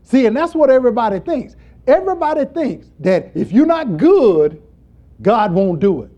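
loud, high pulpit delivery; full-force preaching. Real, not acted. Preacher challenging what everybody thinks about goodness, preaching at full force. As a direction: drive the point home from the pulpit.

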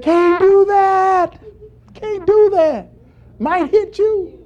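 high, loud called-out reply; hollering back. Retold or imitated. Friend refusing to shoot into the tree, hollering back. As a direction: call it slowly up into a tree.

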